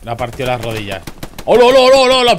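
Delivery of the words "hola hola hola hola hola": high, loud sing-song greeting; excited shout. Real, not acted. Streamer hollering hello at a sudden brawl. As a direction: yell a playful hello at the chaos.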